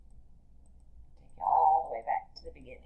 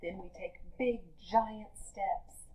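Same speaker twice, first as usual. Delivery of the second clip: lively read-aloud for a class; reading to children; 5 dB quieter than usual. Acted. Teacher reading a picture book to her class, lively read-aloud.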